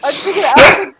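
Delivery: loud, high giggly confession; nervous laughter. Real, not acted. Debater owning up to triggering Alfred, nervous laughter. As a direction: burst out with it while cracking up. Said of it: "nervous laughter".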